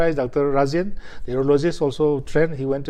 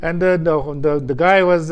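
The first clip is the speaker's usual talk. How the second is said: normal talking voice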